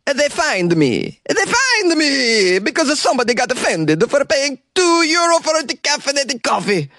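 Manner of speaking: high, whiny hammed-up Italian accent; exaggerated Italian accent. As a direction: ham up an outraged Italian café owner. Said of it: "Here's a quote from the owner".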